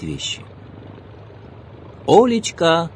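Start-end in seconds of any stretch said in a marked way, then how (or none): 2.1-2.9 loud call across the yard; shouting. A narrator's reading. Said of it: "закричал мужчина".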